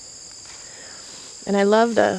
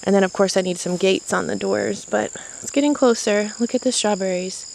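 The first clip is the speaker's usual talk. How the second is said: normal talking voice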